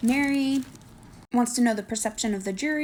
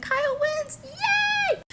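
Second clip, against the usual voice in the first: high squealing cheer; excited; 3 dB louder, much slower than usual. Real, not acted. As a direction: celebrate the win with a yelp. Cheering Kyle's win.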